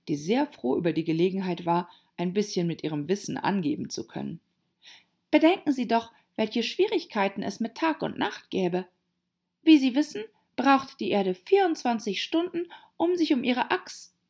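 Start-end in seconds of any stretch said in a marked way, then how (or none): 5.3-14.1 hohe, eifrige Mädchenfigur; Alice, altklug belehrend. Acted. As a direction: eifrig und hoch mit Wissen angeben. Voices Alice showing off knowledge. (altkluge Mädchenfigur, hohe Stimme)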